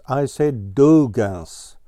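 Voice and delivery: "Dugan's" stressed and slowed, correcting; retort. A narrator's reading. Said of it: "retorted Ground Squirrel".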